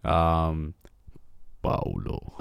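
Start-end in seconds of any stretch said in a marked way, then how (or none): 1.6-2.3 very low breathy rumble of the name; exaggerated bass growl; deepest voice. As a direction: drop as deep as you can go. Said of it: "let me hear your deep voice".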